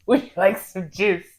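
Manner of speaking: slow, sugary offer of juice; sweet offer. Retold or imitated. Nurse repeating her coworker's juice offer, slow and sweet. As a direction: coax them gently with juice. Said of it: "that was her go-to".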